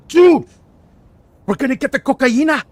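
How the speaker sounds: loud, high celebratory yell; excited holler; thrilled shout. Real, not acted. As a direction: burst out with giddy triumph. Celebrating the new drug contact.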